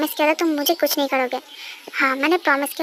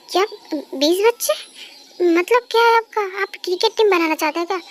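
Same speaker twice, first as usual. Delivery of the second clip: shocked disbelief; 4 semitones higher than usual. Acted. Wife shocked at twenty children.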